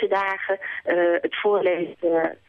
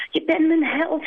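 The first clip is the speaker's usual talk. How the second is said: higher, adoring hen; flattering hen; 10 semitones higher than usual. Acted. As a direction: gush over your hero. Kip flattering.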